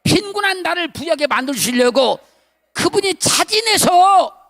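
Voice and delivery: loud, high preacher's cry; fervent preaching. Acted. Pastor retelling his revelation to church, loud fervent preaching. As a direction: shout the revelation with fervor.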